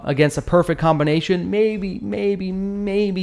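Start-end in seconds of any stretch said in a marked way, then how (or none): 1.5-3.2 high, drawn-out sceptical "maybe"; doubtful maybe. Real, not acted. Grudging best-case guess about weak damage.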